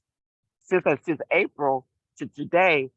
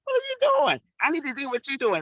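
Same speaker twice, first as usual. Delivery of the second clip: envious admirers; 9 semitones higher than usual. Retold or imitated. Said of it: "everybody is looking at me like".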